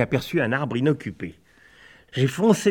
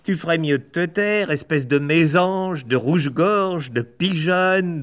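higher, slow, drawn-out insults; higher, slow name-calling; insulting hen blackbird; 6 semitones higher, 3 dB louder than usual. Acted. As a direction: spit each insult out slowly. Birds trade insults.

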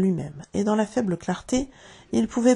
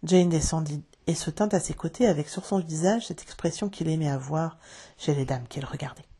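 plain reading voice

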